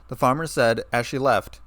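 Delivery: ordinary delivery, nothing marked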